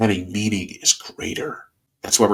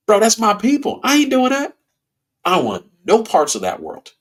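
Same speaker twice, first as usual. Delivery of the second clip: emphatic refusal; 7 semitones higher than usual. Retold or imitated. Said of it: "And I'm like".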